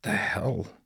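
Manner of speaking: soft, baffled mumble under the breath; mumbled. Acted. Doug mumbles after the seagull flies off, soft and puzzled. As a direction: mutter it to yourself, bewildered. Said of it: "Doug mumbled".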